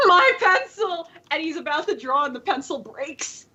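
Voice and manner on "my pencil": high, slow mock anguish; artist's cry. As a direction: wail it over the lost pencil. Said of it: "he's like".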